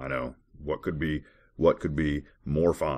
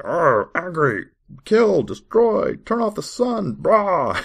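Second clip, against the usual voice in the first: much higher, much louder, slow caveman grunts of a ranting god; mock raging God; 9 dB louder, much slower than usual. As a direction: bellow it like a brainless angry god. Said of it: "He's like".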